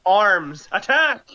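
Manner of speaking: clearer, fuller called-out command; mock battle yell. Game master repeats order for players who didn't hear, mock yell. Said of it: "We still didn't hear you".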